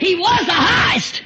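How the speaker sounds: high, loud, slow shout; preacher crying out; preaching shout. Real, not acted. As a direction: lift it up and cry it out. Preaching climax.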